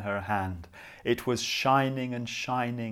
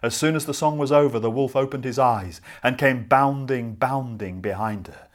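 fast, louder chase narration; excited narration; 8 dB louder than usual. A narrator's reading. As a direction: rush it like the wolf pounces. Wolf chases the girl.

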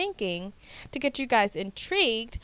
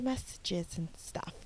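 whispered aside; 3 semitones lower, 10 dB quieter, slower than usual. Real, not acted. Trailing aside.

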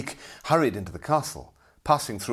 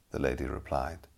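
ordinary delivery, nothing marked

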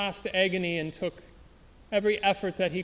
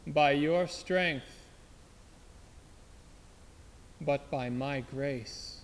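slow gentle divine voice; 4 semitones lower, much slower than usual. Acted. God's voice, slow and soft.

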